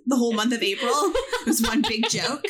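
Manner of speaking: amused, laugh running through the words; laughing remark. Real, not acted. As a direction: joke through your own laughter. Laughing along at the story.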